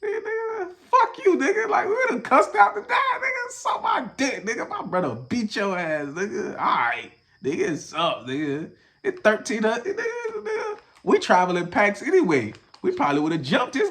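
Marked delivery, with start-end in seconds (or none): 0.0-10.8 put-on high, squeaky cussing teen; rude teen voice. His younger self cussing out the dad, high and squeaky. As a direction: cuss out the dad like a wild kid. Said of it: "we were rude as hell".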